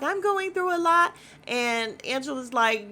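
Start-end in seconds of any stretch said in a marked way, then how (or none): 0.0-1.1 high, louder, self-pitying whine; whiny high plea. Retold or imitated. Retelling Britt's complaint as a high whine. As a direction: play the cast member whining for sympathy. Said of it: "She's like".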